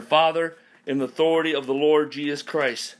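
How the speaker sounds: loud preacher's prayer, slowing at the end; fervent prayer. Acted. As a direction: call out to the Father with force. Opens closing prayer.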